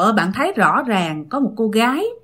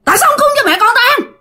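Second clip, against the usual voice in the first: very high, fast furious shriek; high, fast, loud scolding scream; ghost screaming in rage; 13 semitones higher, 4 dB louder, much faster than usual. Retold or imitated. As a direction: shriek it at the intruders. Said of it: "Nạt lên, la làng lên các bạn".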